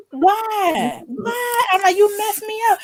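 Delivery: high-pitched retold whine of protest; upset protest. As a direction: act out an upset teen confronting mother. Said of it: "I was all upset and everything".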